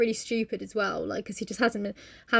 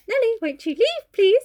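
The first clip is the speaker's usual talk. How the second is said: high, sugary mock-polite plea; forced niceness; 12 semitones higher, 5 dB louder than usual. [name] asking Nellie to go, forced niceness. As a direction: try hard to sound nice. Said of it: "trying to be nice about".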